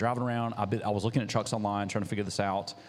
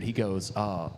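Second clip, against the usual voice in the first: usual speaking voice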